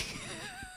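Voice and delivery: a sudden squealing giggle; high squeal of laughter. Real, not acted. Laugh before quoting friend.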